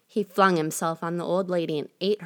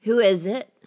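louder, lightly gruff wolf call; a wolf faking grandma, lightly gruff; wolf calling through the door, a hint of gruffness; 3 semitones higher, 5 dB louder than usual. A narrator's reading. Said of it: "hearing the wolf's gruff voice".

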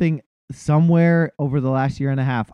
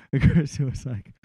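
ordinary talk, nothing marked

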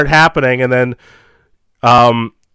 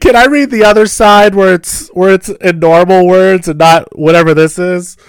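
high, louder whiny student plea; pleading student; 6 semitones higher, 5 dB louder than usual. Retold or imitated. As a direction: beg to skip the hard text.

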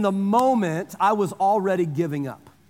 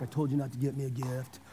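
grudging mutter; 10 semitones lower, 12 dB quieter than usual. Retold or imitated. Grudging reply to wife's gift.

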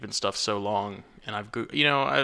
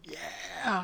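high, drawn-out "yeah"; thrilled kid reliving the moment; retold excited cheer; 9 semitones higher, 4 dB quieter than usual. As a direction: cheer it high without raising the volume. Retold delight at live scorpion.

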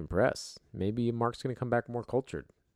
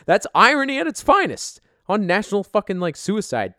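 excited rant; 10 semitones higher, 8 dB louder than usual. Real, not acted. Host ranting about the suicide story.

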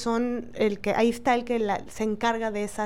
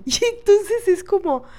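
laughing; 8 semitones higher, 4 dB louder than usual. Real, not acted. Laughing in disbelief at nurse.

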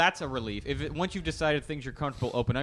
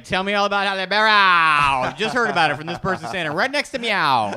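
retold gush, high and loud; excited blurting; 6 semitones higher, 10 dB louder than usual. Speaker re-enacts failing to hold in gossip, excited blurting. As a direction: blurt it out, mock-bursting with the secret. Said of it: "I was just so excited".

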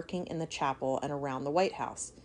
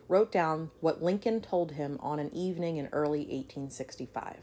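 ordinary delivery

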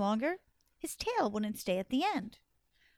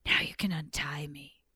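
low, breathy snake request; python voice; 7 semitones lower than usual. Acted. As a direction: speak low and half-whispered as the python. Tied python asks for release.

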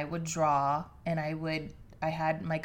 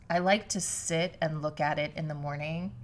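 ordinary talk

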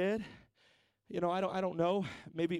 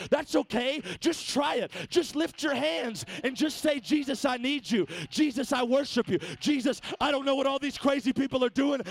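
higher, ringing preacher's call; full-force preaching; 6 semitones higher, 4 dB louder than usual. Acted. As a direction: fire up the congregation.

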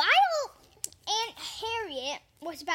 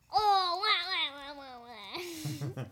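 mock crying Joker; 2 semitones lower, slower than usual. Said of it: "Joker said".

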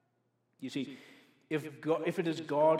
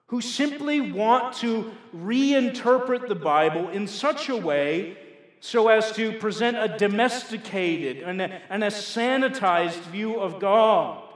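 loud, raised-pitch, slow pulpit denunciation; forceful preaching; 6 semitones higher, 13 dB louder than usual. Real, not acted. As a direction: hammer each phrase out at the congregation. Preacher denounces reinterpretations.

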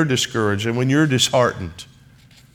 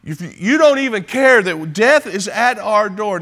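much higher, louder, frantic plea; terrified cry; 7 semitones higher, 4 dB louder than usual. Retold or imitated. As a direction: cry out as if the boat is sinking. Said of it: "they're certain".

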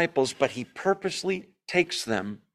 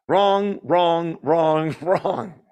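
emphatic wrong; 3 semitones higher, much slower than usual. Acted. Rejecting each answer in turn.

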